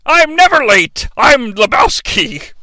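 mock pompous shout; blustering Santa. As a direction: puff yourself up and bellow. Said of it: "He's like".

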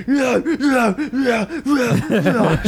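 high-pitched frantic fighter yelps; mock punching cries, high and repeated; Tommy Gunn. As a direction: imitate a fighter yelping with each wild punch. Imitating Tommy flailing wildly while sparring.

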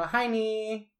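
high, goofy hello; silly greeting. Pretend. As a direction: chirp it like a weird greeting. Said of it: "wave at people and go".

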